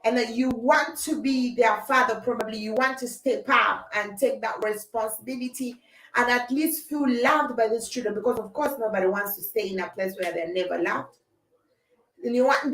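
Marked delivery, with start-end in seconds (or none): none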